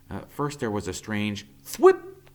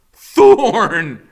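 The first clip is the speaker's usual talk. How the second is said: startled cry; 13 semitones higher, 16 dB louder than usual. A narrator's reading. Cub seen dangling.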